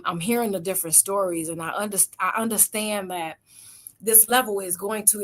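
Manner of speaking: ordinary talk, nothing marked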